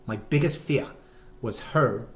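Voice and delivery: put-on botched British accent, "fear" without its r; muddled fake accent. Dad confesses his worry, in muddled fake British accent. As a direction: say "fear" as "fee-ah" in a faked accent. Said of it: "I don't know what accent that is".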